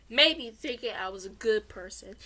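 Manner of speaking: usual speaking voice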